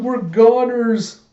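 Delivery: high, loud, drawn-out wail; panicked wail. Retold or imitated. York sure the tornado will kill them, panicked wail. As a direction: wail slowly in comic despair.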